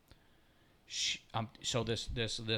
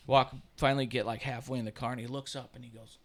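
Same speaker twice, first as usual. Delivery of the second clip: ordinary talk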